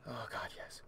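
low, breathy whisper; whispered relief. Real, not acted. Player finding a toy at the ready, whispered relief. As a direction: whisper your thanks in relief.

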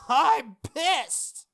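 high, loud, fuming outburst; furious girl. Acted. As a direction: blow up at him and cast. Rin furious, uses command spell.

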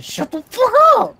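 high, loud mock yell; retold yell. Player retelling how he yelled at his friends, loud mock yell. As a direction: bark it out like a startled shout. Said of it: "I was just like".

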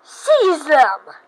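loud villain command; commanding shout. Acted. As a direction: bark the order loudly. Villain orders his minions.

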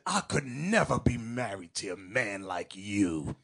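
low, slow, drawn-out confession; admiring sister impression. Sister telling the bishop her feelings, low and slow. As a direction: say it low and lingering.

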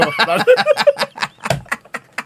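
high-pitched laughing fit; high giggle. Real, not acted.

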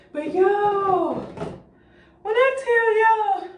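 1 excited squeal. Real, not acted. Excited showing her shower setup.

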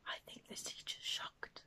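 breathy secret aside; whispered aside. Acted. Storyteller whispering to children about the teacher, secretive. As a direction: whisper it to the kids.

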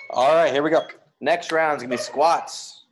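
rally the class into the next round; loud callout. Acted. Coach restarts drills.

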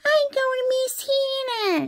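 high mock whine; tearful baby toy. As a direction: whine it like a pretend crying baby. Voices crying baby toy.

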